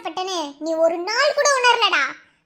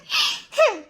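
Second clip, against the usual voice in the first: wicked laugh; 5 semitones higher than usual. Acted. Villain laughs at trapped husband.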